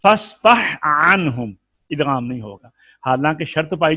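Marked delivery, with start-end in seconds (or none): 0.0-1.5 फस्फह ending in a clear breathed h, then अन्हुम separately; slow recitation, keep the h of फस्फह apart from अन्हुम; without merging. Shown as a demonstration. Teacher showing how to read it, फस्फह अन्हुम slow and unmerged. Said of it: "तो हम इसको कैसे पढ़ेंगे?".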